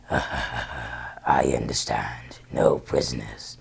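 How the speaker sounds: low, slow whispered robot voice; slow low robotic whisper; Soundwave reply. Retold or imitated. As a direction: answer in a cold hush. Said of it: "He's like that".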